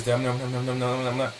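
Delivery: low, soft indistinct mumble; mumbling. Shown as a demonstration. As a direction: mumble nonsense under your breath. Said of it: "I start mumbling".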